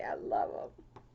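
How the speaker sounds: whispered, touched; hushed, moved whisper; soft whisper. Real, not acted. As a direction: breathe it out like a secret thank-you. Touched by friend's card.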